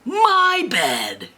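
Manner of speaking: high, drawn-out storybook bear cry; outraged bear. Acted. Mama Bear finds someone slept in the bed, outraged.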